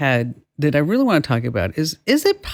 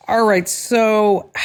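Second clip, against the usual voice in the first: ordinary delivery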